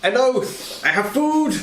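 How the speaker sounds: high, bright shout of greeting; excited greeting. Acted. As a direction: call out happily in character. Player greets companion in character.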